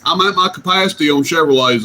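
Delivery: ordinary delivery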